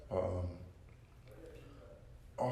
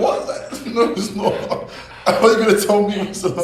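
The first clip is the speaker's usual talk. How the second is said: laughing; 11 semitones higher, 12 dB louder than usual. Real, not acted. Disbelief at Paris in London.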